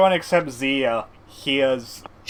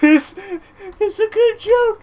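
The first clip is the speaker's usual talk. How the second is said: high, loud words squeezed through tears of laughter; laughing while talking; 8 dB louder, slower than usual. Real, not acted. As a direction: barely get the words out for laughing. DM still laughing, defending the joke.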